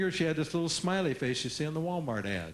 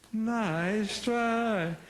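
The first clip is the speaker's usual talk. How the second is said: grinning nice try; 6 semitones higher than usual. Shown as a demonstration. Teacher answering a kid.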